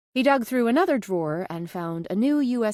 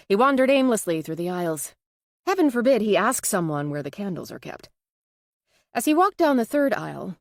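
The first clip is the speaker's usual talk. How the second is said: ordinary delivery, nothing marked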